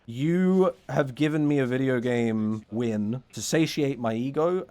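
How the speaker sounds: ordinary talk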